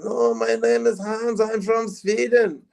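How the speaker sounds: put-on high, slow Swedish tourist accent, insisting; fake Swedish accent. Robber telling cops he is Hans from Sweden, fake Swedish accent. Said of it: "you put on the voice".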